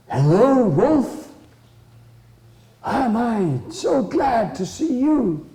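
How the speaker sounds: high, eager hello; glad mouse greeting. Acted. Little mouse meets the grey wolf, high and delighted. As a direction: greet an acquaintance in a high, drawn-out voice.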